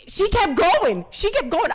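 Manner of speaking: high, slow, outraged emphasis. Real, not acted. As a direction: stretch it out in disbelief. Outraged retelling, voice jumps up.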